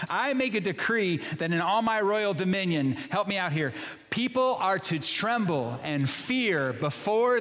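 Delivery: slow, proclaimed scripture reading; reading Darius's decree. Shown as a demonstration. Pastor leading the standing congregation in reading Darius's decree. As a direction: read it aloud like a royal decree. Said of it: "read the words of Darius".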